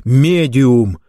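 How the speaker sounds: touch of alarm; alarmed shout. A narrator's reading. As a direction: call out a warning. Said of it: "закричал Рауль в тревоге".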